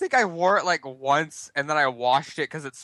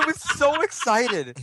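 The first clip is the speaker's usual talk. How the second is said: high, drawn-out SO through a laugh; laughing, excited; 8 semitones higher, slower than usual. Real, not acted. Recalling his joy, laughing.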